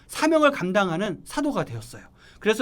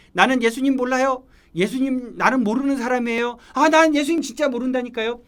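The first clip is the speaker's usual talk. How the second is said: acting out a higher, louder protest; insistent denial; 5 semitones higher, 4 dB louder than usual. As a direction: deny it more forcefully each time. 베드로가 예수를 부인하는 장면 연기. (다급하게 잡아떼는 연기)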